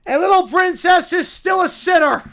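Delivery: high, loud, hammed-up condemnation; shouted accusation. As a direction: condemn her at full voice. Acted character accuses the princess.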